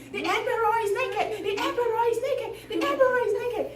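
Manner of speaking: high-pitched chanted mock shout; crowd shouting. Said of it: "they were shouting".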